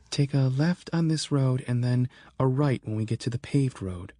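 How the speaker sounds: Sarah giving directions. Acted.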